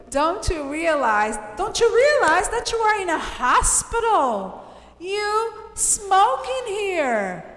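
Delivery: high, loud, outraged reading; emphatic scolding. Shown as a demonstration. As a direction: scold the smoker with heavy stress, slowing on the last sentence. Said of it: "So much emphasis on smoking".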